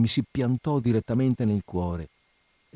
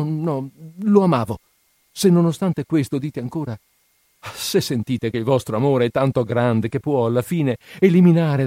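higher, urgent pleading in mounting waves; impassioned Nastenka; 5 semitones higher, 4 dB louder than usual. A narrator's reading. Nastenka offering her love to her friend, fervent and pleading. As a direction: build the conditions up to a fervent vow.

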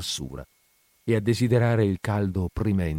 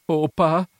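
higher cry of alarm; boy crying out; 8 semitones higher, 4 dB louder than usual. A narrator's reading. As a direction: cry out to father. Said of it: "Il ragazzino gridò". (grido spaventato)

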